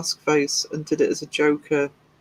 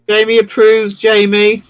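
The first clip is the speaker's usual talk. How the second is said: loud announcement; 4 semitones higher, 8 dB louder than usual. Real, not acted. Announcing viewer approval.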